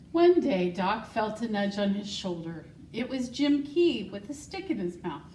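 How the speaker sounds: put-on reading a picture book to children; story reading. School secretary reading Wonder Horse to the class, storytelling voice. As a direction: read it warmly and clearly to young listeners.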